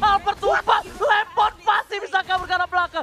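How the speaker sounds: high, loud hype call; fighting game announcer. Acted. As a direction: scream the big play. Climax of teamfight call.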